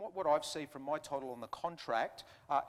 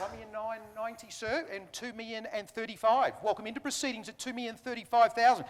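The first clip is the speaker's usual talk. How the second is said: brisk auction patter; auctioneer calling; 6 semitones higher, 6 dB louder than usual. Real, not acted. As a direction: rattle off bids like an auctioneer.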